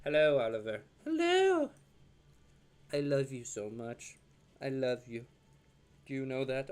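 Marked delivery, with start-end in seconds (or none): 1.0-1.7 much higher, squeaky "Hello" for the pup; squeaky dog voice. Real, not acted. Owner cooing to his puppy in a high squeak. 2.9-6.7 tender to dog. Real, not acted. Tender words to his dog.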